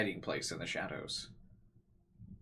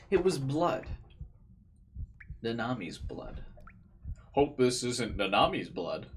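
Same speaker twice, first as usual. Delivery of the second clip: dramatic character read; 2 semitones higher than usual. Acted. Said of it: "I'm just voice acting Takumi".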